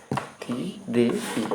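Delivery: थेरेपी stretched very slowly and softly; drawn-out pronunciation. Shown as a demonstration. Teacher models थेरेपी for learners, very slow and soft. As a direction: drag थेरेपी out syllable by syllable. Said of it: "थेरेपी होता है".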